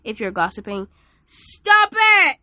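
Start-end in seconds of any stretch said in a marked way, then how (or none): 1.2-2.4 much higher and louder snap; sharp yell. Real, not acted. Preacher scolding gossiping listeners, sharp loud yell. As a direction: bark the order at them.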